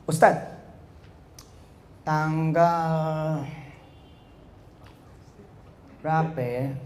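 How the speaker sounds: slow, drawn-out words with long gaps; hesitant speaking demo. Shown as a demonstration. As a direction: drag it out as if unsure of the date. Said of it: "Ngomongnya gimana?". (lambat, ragu-ragu, kata ditarik panjang)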